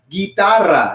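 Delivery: guitarra as "gee-TAR-ra", hard g; Spanish pronunciation. Shown as a demonstration. Teacher translates guitar for the class, Spanish pronunciation. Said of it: "In Spanish, you can pronounce it as".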